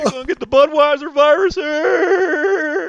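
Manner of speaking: high, loud, stretched-out squeal; mock objector voice. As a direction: squeal it slowly in mockery. Joins in mocking the outraged objector.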